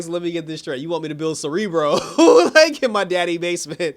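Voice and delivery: real laughter breaking in; laughing. Real, not acted. Retelling Chester's Cerebro line while cracking up. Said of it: "I started laughing".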